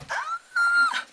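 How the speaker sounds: sharp, very high yelp of alarm; a sudden high squeal of fright; startled yelp. Real, not acted. Vlogger startled by the mishap, sharp high yelp.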